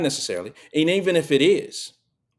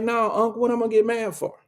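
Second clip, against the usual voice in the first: nephew's voice; 8 semitones higher than usual. Retold or imitated. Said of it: "he was like".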